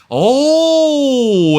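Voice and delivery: loud high startled oh; surprised cry. Real, not acted. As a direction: gasp out loud at the hit. Sudden contact in the race.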